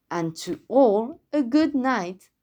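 ordinary delivery

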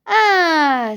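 high, loud, long falling "ah"; delighted discovery. A narrator's reading. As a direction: let out a satisfied cry. Small bear finds perfect tree. (émerveillé, « ah » prolongé)

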